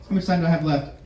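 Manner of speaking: ordinary talk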